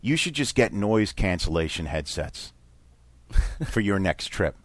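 low, soft, understated advice; imitated low-key husband. Stranger husband advising a dad, low-key. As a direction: say it low and quiet, matter-of-fact. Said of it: "He goes".

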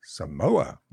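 "Samoa?" rising in disbelief; surprised question. Retold or imitated. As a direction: echo the word back, amazed, lifting at the end. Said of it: "I said".

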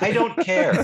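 high, punchy fed-up cry; exasperated outburst. Acted. Fan rooting for any Canadian team, exasperated. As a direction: throw your hands up vocally.

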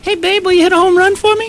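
put-on high squeaky kid's plea. A kid begging Babe Ruth for a home run, squeaky high voice. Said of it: "Billy Crystal ... he goes".

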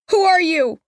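put-on high, anxious child's question; frightened girl. A narrator's reading. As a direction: ask from inside the coffin, scared. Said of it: "the desperation they carry".

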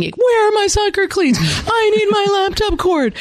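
high-pitched, hammed-up kid demand; demanding kid impression. Kids demanding things from mom, mock yelling. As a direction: holler like a kid who needs it now. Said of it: "they're usually saying".

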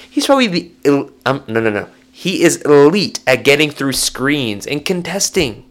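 ordinary delivery

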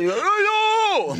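high loud dismayed cry; shocked yelp. Retold or imitated. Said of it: "And then you said".

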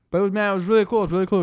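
talking while eating; mouth full. Real, not acted. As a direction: speak through a mouthful of food. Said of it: "I'm sorry, I'm eating".

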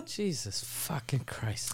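slow, soft whispered curse; disbelieving whisper. Real, not acted. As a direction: breathe it out in disbelief. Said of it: "reacting to the story".

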